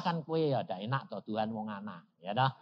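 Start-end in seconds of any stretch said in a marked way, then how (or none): none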